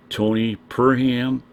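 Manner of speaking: normal talking voice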